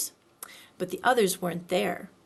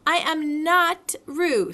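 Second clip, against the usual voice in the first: louder, slow insisting Piglet; loud indignant correction; 6 semitones higher, 6 dB louder, slower than usual. A narrator's reading. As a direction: stamp out each word. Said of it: "said Piglet loudly".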